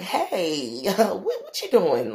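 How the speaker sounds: light nervous laugh, trying to sound casual. Retold or imitated. Nervous laugh on finding roaches in a date's kitchen. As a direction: laugh it off so you don't embarrass him. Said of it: "I was nervous".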